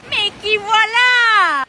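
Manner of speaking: slow, loud "gotcha" from the boss; menacing boss. Acted. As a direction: drag it out like a villain who caught her. Boss catches her fleeing.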